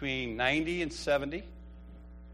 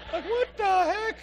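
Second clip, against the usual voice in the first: usual speaking voice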